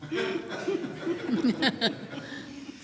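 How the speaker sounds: hearty laugh. Real, not acted. [name] laughs at his joke.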